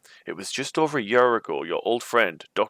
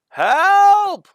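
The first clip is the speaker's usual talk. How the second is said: a much higher, much louder scream for help; cry for help; 13 dB louder than usual. A narrator's reading. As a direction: shout it up from the ledge below. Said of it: "you cry".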